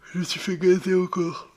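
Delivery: high, loud gleeful cry; thrilled yell at the loot; excited shout. Real, not acted. Delighted at loot found.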